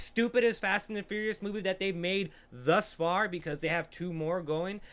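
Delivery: ordinary talk, nothing marked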